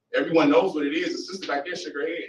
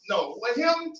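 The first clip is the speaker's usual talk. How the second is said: higher, playacted disbelief; hammed-up who-him surprise; mock surprise; 10 semitones higher, slower than usual. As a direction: fake astonishment at the praise. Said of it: "She would act surprised".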